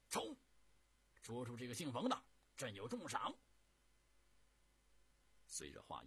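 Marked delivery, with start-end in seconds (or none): 0.1-3.4 high, loud battle cry; loud commanding shout. A narrator's reading. Emperor ordering his generals to charge, loud shout. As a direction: charge order thrown out hard and fast. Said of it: "大声喝道".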